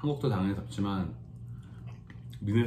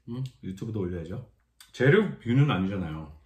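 food held on chopsticks; pausing mid-meal to talk; talking between bites. Real, not acted. Seen 3: Holds food on chopsticks and talks; no bite taken in this stretch.

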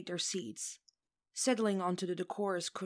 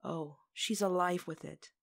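ordinary delivery, nothing marked

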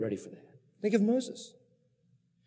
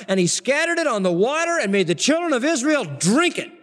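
louder, higher, building scripture read; fiery preacher reading the wrath; preaching at full force; 8 semitones higher, 5 dB louder than usual. Acted. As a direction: thunder out Moses' anger.

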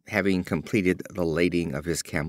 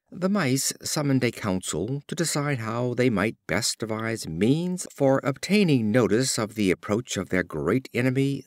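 usual speaking voice

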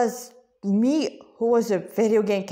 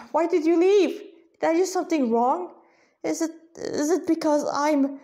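stumbling, pleading; 6 semitones higher than usual. Acted. Devotee to his unseen Lord, a raised, halting plea. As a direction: beg him not to have left, tripping over the words. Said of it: "Krishna Kripa stumbled on his word".

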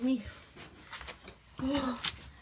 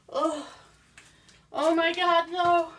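ordinary talk

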